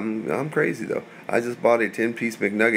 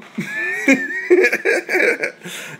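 sudden squealing laugh, pitched way up; high burst of laughter. Real, not acted. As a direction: crack up into a shrill burst of laughter. Laughs after his own quote.

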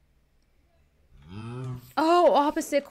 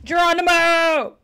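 jumping yell; 5 semitones higher, 6 dB louder, slower than usual. Real, not acted. Jumps into the portal.

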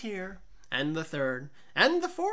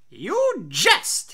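loud, startled outburst of disbelief; startled exclamation; 4 semitones higher, 8 dB louder than usual. A narrator's reading. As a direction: blurt it out, caught off guard. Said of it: "he exclaimed".

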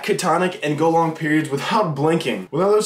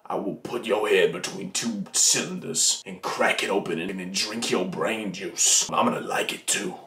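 very low, softer mock-possessed voice; creepy voice; 7 semitones lower, 5 dB quieter than usual. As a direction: sink into a deep, quiet, put-on creepy menace. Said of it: "let me try my creepy voice".

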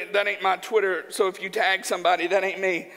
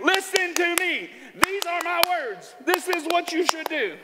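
mock bossy preacher; 7 semitones higher than usual. Preacher mocking a pushy teacher, haughty put-on voice. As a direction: put on a pompous know-it-all preacher.

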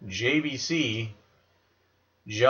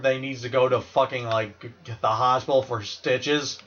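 ordinary talk, nothing marked

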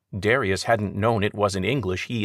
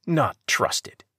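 higher, pointed jab; snapped back; 8 semitones higher than usual. A narrator's reading. Chief finishing his retort at Webb, higher sharp jab. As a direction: spit the last words out. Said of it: "Kale snapped back".

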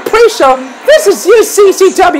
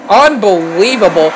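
ordinary talk, nothing marked

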